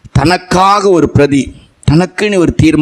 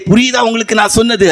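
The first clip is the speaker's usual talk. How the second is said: ordinary delivery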